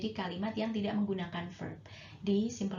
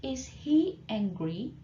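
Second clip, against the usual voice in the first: "Is he angry?" drawn out slowly, rising on "he"; slow model question; 3 semitones higher, much slower than usual. Shown as a demonstration. Teacher slowly models the question for the class. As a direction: spell it out slowly for learners.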